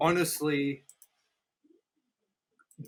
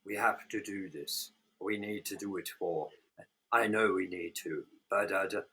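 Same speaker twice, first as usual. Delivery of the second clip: mock wooden movie line, much softer and lower; stale dialogue mockery; 7 semitones lower, 9 dB quieter than usual. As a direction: read it like a bored actor going through the motions. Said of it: "And the dialogue was like".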